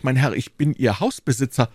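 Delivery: usual speaking voice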